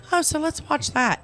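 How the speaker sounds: high-pitched, casual discovery; lightly acted "oh, let's watch this"; eager self-quote. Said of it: "then I'm like".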